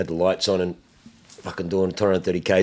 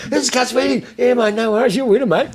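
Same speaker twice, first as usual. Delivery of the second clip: mock cop voice; 13 semitones higher, 3 dB louder than usual. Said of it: "He's just like".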